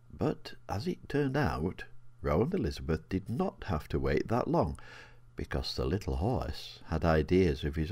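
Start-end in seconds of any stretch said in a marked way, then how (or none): none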